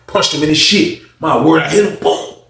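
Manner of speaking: plain reading voice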